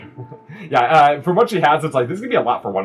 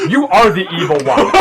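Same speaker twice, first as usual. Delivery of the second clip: laughing; 12 semitones higher, 8 dB louder than usual. Acted. Cook cracks up mid-argument.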